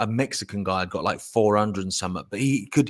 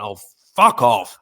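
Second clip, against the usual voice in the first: slow louder fed-up outburst; exasperated oh fuck off; 7 dB louder, slower than usual. Retold or imitated. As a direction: snap it out at the dropped thing. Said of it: "I went".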